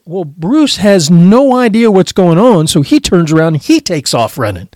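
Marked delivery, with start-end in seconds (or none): none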